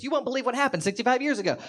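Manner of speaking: high, fast, breathless gossip; excited gossip. Retold or imitated. Comic playing mom spreading old town gossip, excited. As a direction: gush it like thrilling news.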